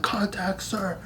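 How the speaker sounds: small-voiced mock answer to a superior; meek recruit. Recruit admits to drill instructor, high soft mock voice. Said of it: "I was like".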